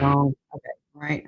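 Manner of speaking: normal talking voice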